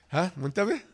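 normal talking voice

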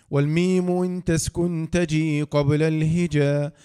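slow, loud chanted reading of tajweed verses; poem recitation. Acted. Teacher closes the course reciting the Tuhfa poem, slow chant. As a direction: chant the didactic poem line by line, slowly.

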